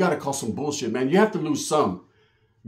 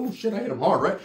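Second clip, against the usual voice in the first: usual speaking voice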